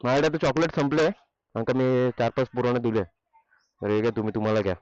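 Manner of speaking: loud calling, peaking on the last call. Real, not acted. Calling kids to hand chocolates.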